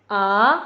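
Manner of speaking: "a" sliding upward; "a" rising like do to mi; second tone. Shown as a demonstration. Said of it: "Itu yang nada kedua".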